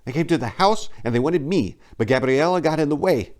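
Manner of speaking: desperate to make him understand; exasperated. Acted. Maria explaining the attack to Enrico, exasperated. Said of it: "beginning to gesticulate in exasperation".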